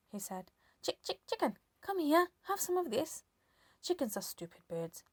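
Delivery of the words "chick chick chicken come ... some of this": coaxing hen. A narrator's reading.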